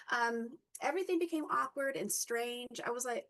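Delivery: ordinary talk, nothing marked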